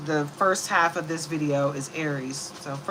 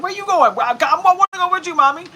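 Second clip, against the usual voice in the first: high, loud, fast pleading dog voice; whiny puppy voice; 12 semitones higher, 12 dB louder, faster than usual. Retold or imitated. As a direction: beg to come along like a clingy pup.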